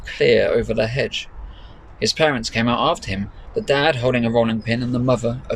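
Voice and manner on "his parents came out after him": ordinary delivery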